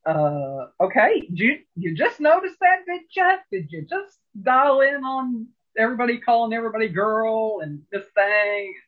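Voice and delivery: high, incredulous campy sass; sassy comeback. Retold or imitated. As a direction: needle him with mock disbelief. Sassy retort to the heckler.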